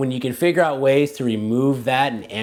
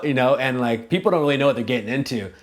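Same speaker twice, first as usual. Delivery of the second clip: ordinary talk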